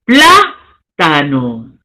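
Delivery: plátano drawn out slowly, syllable by syllable; pronunciación lenta por sílabas. Shown as a demonstration. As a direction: stretch each syllable of plátano. Teacher models syllable stress.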